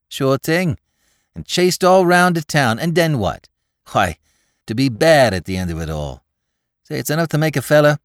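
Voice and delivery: put-on excited young New York tough; Bowery boy Spike. A narrator's reading. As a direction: rattle it off like a breathless Bowery lad. Spike voiced by narrator.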